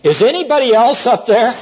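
high-pitched call upward for anyone else; comic calling out. Acted. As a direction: call up hopefully, playing it for laughs. Beetle calls for another rescuer.

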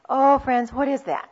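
ordinary talk

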